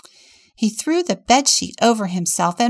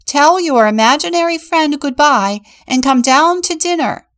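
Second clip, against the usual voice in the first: loud, high summons to dinner; mom calling out; 8 semitones higher, 10 dB louder than usual. Acted. Mother calling her daughter to dinner, loud high call. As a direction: shout it through the house so she hears. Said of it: "a woman's voice came from another room".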